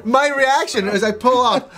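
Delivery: ordinary talk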